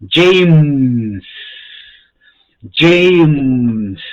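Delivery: James said loudly, English dj and a clear final m; English pronunciation. Shown as a demonstration. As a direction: model James slowly for the class to repeat. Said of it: "Se dan cuenta la diferencia".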